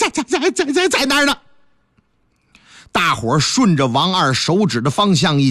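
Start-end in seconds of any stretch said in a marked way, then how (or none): 0.0-1.4 terrified stammer. Acted. Wang Er points out the woman, stammering high in fear. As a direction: stutter the words, voice jumping high.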